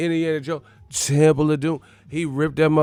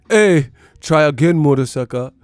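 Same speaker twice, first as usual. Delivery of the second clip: mock Somali pirate; 3 semitones higher, 7 dB louder than usual. Streamer returns to pirate taunting, mock Somali accent. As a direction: bark it in a put-on Somali accent. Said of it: "Michael Blackson ass".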